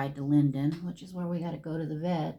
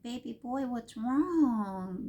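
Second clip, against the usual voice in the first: tender worry; 5 semitones higher, much slower than usual. Retold or imitated. Owner asks her hurting puppy what is wrong, tender baby talk. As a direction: coo over a sick pet.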